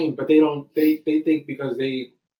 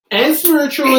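emphatic; 11 semitones higher, 9 dB louder than usual. Acted. Ends list with a loud flourish.

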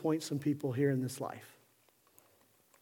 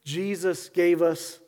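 normal talking voice

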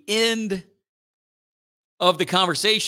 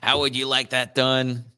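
a light mock robot waiter asking; stiff, machine-like question about the steak; eighties robot voice; 3 semitones lower than usual. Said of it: "talk like stereotypical eighties robots".

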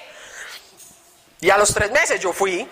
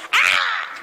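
high, comic shriek of dismay; squealed "ah" of horror; mock scream; 9 semitones higher than usual. Mock scream at two fines arriving.

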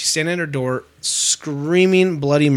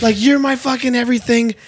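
high-pitched mock hysterics; screaming, crying girlfriend; 8 semitones higher than usual. Girlfriend pleading with her man, mock hysterical. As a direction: imitate a distraught girlfriend in a raised pitch. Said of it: "it's a girl screaming, crying".

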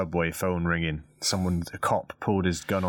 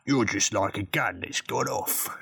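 slow, lightly done Cockney drawl as Michael Caine; a hint of Michael Caine's Cockney, taken slowly; Michael Caine impression; slower than usual. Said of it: "that's Michael Caine".

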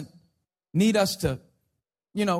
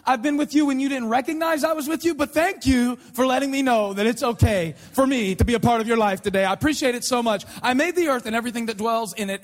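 sarcastic God. Acted. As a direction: feign being grateful for a needless invitation. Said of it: "isn't as sarcastic as I am".

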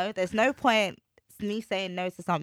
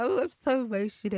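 imitating dad; 6 semitones higher than usual. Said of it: "my dad was like".

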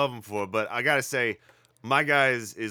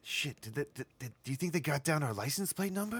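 whispering; 9 dB quieter than usual. Acted. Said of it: "We don't have to whisper this".

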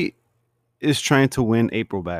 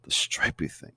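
whispered, unimpressed echo; mock deadpan whisper of disbelief; deadpan repeat; 7 dB quieter than usual. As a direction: repeat it flatly under your breath. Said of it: "Ron's like".

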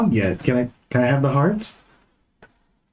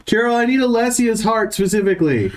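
booming magic voice; 10 semitones higher than usual. Acted. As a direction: cast your voice across the halls.

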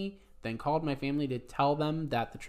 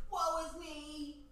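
melodramatic; 13 semitones higher, 11 dB quieter, much slower than usual. Pretend. Dramatic mock lament.

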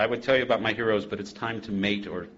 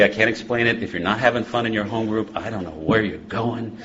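ordinary talk

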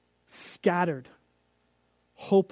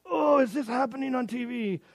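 higher, shouted cringe; mock disbelief; 7 semitones higher than usual. Viewer watching the TV couple, mock shocked shout. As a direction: cry out at the screen in disbelief.

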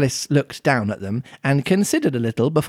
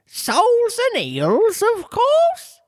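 very high, slow impatient beast; squeaky and huffy; impatient Griffin; slower than usual. A narrator's reading. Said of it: "replied rather impatiently".

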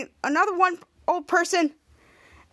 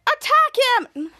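high, loud command; rally the others to charge; battle cry; 9 semitones higher, 5 dB louder than usual. Retold or imitated. Elderly woman ordering the attack, shouted.

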